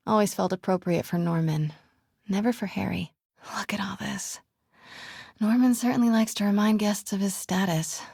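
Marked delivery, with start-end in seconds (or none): 3.6-4.4 soft awed whisper; hushed and softer whisper. Real, not acted. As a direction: breathe it, taking in the room. Sneaking through Osborn house.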